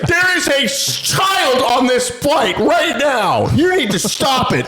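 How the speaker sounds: high-pitched screech, drawn out slowly; retold screamed plea. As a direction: imitate a mother shrieking at brawlers. Lady screams to stop fight.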